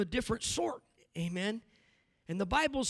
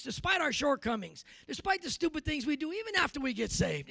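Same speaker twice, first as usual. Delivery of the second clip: normal talking voice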